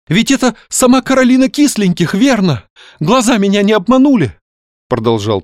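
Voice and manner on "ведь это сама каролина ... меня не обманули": high-pitched, starstruck gushing; excited. A narrator's reading. Young man asks about Каролина Кисленьких, wildly excited. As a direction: gush on without listening, voice pitched high. Excited youth recognises famous novelist, heedless.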